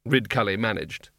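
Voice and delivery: ordinary delivery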